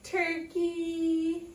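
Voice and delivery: high, loud, slow festive cheer; cheerful greeting. Acted. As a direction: draw out a happy holiday cheer. Reader cheering to child.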